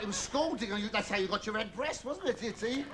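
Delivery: ordinary delivery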